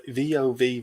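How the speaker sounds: normal talking voice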